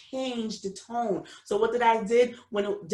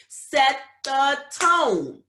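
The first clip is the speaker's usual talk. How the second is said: "set the tone" slow and louder, drawn out; 5 semitones higher, 7 dB louder, much slower than usual. Real, not acted. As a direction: drive "set the tone" home slowly. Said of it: "addresses parents, repeats point".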